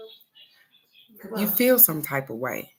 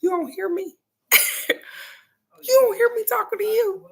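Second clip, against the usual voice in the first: much higher, laughing mock complaint; mock indignant friend. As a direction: squeak it out through a laugh. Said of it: "And she was like".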